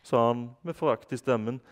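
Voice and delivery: put-on reading a children's book aloud in Norwegian; story narration. A narrator's reading. Reading a chapter aloud to listeners, lively narration. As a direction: tell the story to young listeners.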